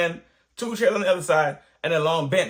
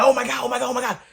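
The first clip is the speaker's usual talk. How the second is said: higher, repeated panicky oh-my-Gods; mock panic, pitched up; freaking out; 6 semitones higher, much faster than usual. Storyteller reliving his shock at the injury, freaking out. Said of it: "So I'm freaking out".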